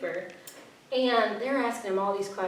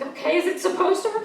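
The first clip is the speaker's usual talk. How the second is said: higher and louder, sceptically; incredulous question; 10 semitones higher, 6 dB louder, faster than usual. Retold or imitated. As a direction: challenge the counselor in disbelief. Retold reply to the counselor.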